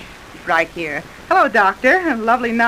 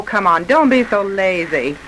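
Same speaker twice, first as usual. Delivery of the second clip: usual speaking voice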